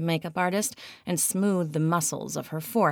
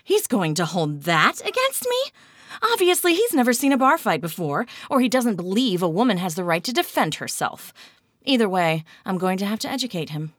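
indignant; 5 semitones higher, 6 dB louder, faster than usual. A narrator's reading. Kat indignant, fast.

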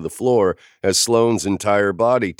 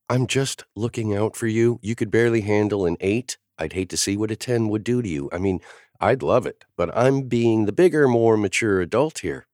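usual speaking voice

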